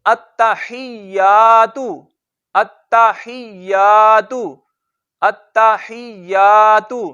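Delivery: अत्तहियातु very slow, tt held, long या; careful recitation of अत्तहियातु with the tt held. Shown as a demonstration. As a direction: stretch each syllable of अत्तहियातु, holding the doubled t. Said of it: "बल्कि".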